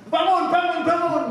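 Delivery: high, loud calling out; urgent wake-up call. Retold or imitated. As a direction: shake him awake with your voice. Stranger rousing unconscious victim.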